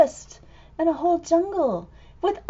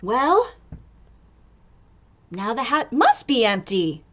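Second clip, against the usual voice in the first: usual speaking voice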